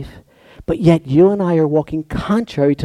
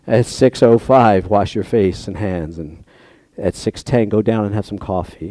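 mock voice of God; 8 semitones lower than usual. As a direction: intone it like God dictating a schedule. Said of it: "we wanna hear every morning".

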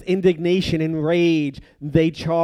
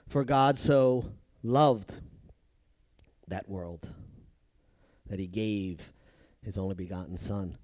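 reverent hush; 8 semitones lower, 8 dB quieter than usual. Acted. Reverently quoting John 3:16.

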